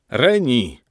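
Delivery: contained storyteller's cry; narrated shout. A narrator's reading. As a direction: call out to her, held back within the reading. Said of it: "krzyknął na cały głos".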